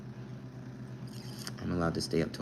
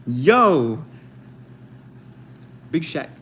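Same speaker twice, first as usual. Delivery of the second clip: high, punchy mock-British shout-out; put-on British hype voice; British accent; 6 semitones higher, 6 dB louder, much slower than usual. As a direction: call it out in playful British slang. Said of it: "With the mean accents".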